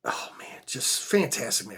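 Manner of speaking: hushed, breathless whisper of awe; whispering over a rare find; awed whisper. Real, not acted. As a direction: whisper it like holding a treasure.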